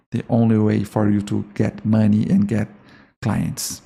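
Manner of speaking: normal talking voice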